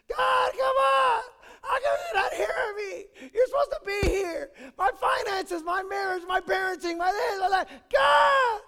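very high, loud tearful protest at God; desperate yelling. Pretend. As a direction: shout and sob at God in frustration.

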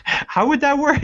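high-pitched mock bafflement; puzzled wondering. Co-host recalls pausing the movie to think, mock puzzled. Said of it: "I had to, like, think".

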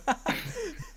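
short squeaky laugh; high laugh. Real, not acted. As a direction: giggle up high. Keeps laughing at the joke.

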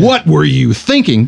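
same question read as a light reproach; mildly scolding. Shown as a demonstration. Host showing how the tweet could sound harsh, mild scolding. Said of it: "you could read it like".

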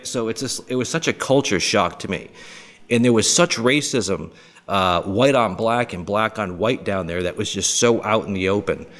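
ordinary talk

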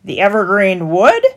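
loud, scoffing repeat; mock the question back. A narrator's reading. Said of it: "mocked the stallholder".

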